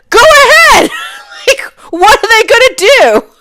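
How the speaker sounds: very high, loud laughing dare; defiant laughing shout at the driver. Retold or imitated. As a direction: dare him, cracking up. Daring the man to call cops.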